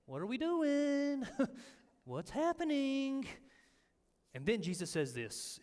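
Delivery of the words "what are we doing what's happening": baffled disciples. Retold or imitated. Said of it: "everybody's kinda looking around saying".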